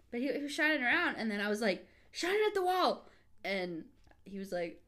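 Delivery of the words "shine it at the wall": excited request. Retold or imitated. Retold request to partner.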